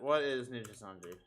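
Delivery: ordinary talk, nothing marked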